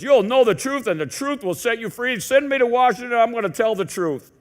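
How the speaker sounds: hammed-up stump speech promise; mock politician. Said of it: "every politician has used this verse".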